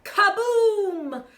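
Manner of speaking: high loud explosion; kaboom. Acted. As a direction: boom it out. Cannon blast.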